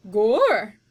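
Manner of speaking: loud startled exclamation; Mig's loud gasp of wonder. Acted. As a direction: blurt in surprise. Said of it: "said Mig".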